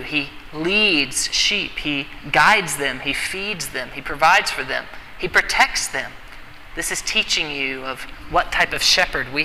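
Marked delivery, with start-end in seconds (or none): none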